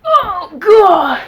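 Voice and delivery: high, loud startled cry; sudden high-pitched alarm. Real, not acted. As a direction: jump at the sudden move. Cat startles her.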